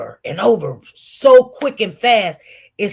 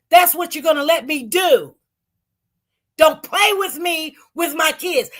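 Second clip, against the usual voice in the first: high, loud, insistent; angry refusal; 8 semitones higher, 4 dB louder than usual. Retold or imitated. To his mother on the phone, high loud angry insistence. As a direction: defy her, you're going home no matter what.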